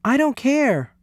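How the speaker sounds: put-on high-pitched boyish protest; cried out. A narrator's reading. Louis defending his pet to Miss Sievers, crying out. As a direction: cry it out in a high voice. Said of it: "cried Louis".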